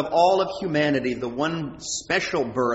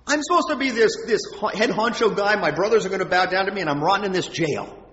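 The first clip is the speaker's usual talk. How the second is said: higher, louder indignant complaint; disappointed protest; 5 semitones higher, 4 dB louder than usual. Acted. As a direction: protest the unfairness of it, voice pitched up. Voicing Joseph's disappointment in jail.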